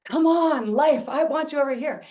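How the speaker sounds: pleading excited summons; eager calling. Retold or imitated. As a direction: call out with longing. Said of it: "We're like".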